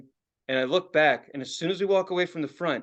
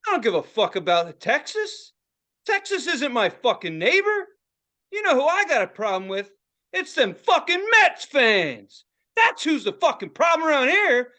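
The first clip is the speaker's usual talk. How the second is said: high mock tirade, building louder; going off; 9 semitones higher, 5 dB louder than usual. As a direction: imitate an irate fan, punching the curses. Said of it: "This guy's going off".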